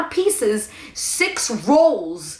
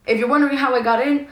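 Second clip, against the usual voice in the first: usual speaking voice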